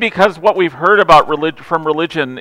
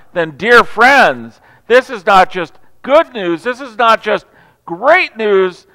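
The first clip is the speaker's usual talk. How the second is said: louder, higher rising sermon climax; fervent preaching; 4 semitones higher, 6 dB louder than usual. Acted. Pastor proclaiming the good news to the congregation, fervent. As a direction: build it like the sermon's peak.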